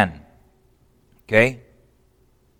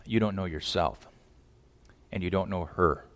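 much softer, low, solemn fatherly caution; soft grave warning; 8 semitones lower, 16 dB quieter than usual. Pretend. Father warning his son about the woman, softly and gravely. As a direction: lower the voice to warn him.

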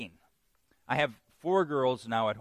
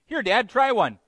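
imitating daughter; 9 semitones higher, 9 dB louder than usual. Daughter offering cookie voiced.

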